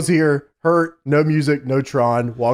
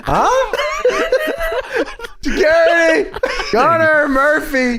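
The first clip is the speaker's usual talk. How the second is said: high, laughing holler of names; mock calling out; 11 semitones higher, much slower than usual. Co-host riffing on the search, mock calling out. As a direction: draw the names out high as pretend calls.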